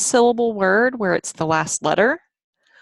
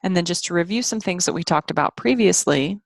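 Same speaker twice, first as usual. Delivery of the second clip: normal talking voice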